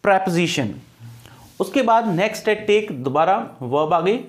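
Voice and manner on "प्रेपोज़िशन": प्रीपोजिशन said "prep-zi-shan", the o dropped; प्रीपोजिशन as "prep-zishan", no "po"; correct pronunciation. Shown as a demonstration. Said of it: "क्या है correct pronunciation".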